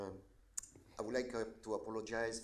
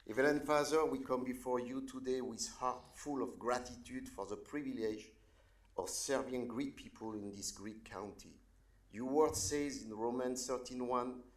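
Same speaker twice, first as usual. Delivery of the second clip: earnest public prayer with sudden swells on the calls to God; invocation prayer; 4 dB louder than usual. Acted. Pastor praying over county leaders, earnest prayer voice. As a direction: pray over the leaders, lifting each appeal.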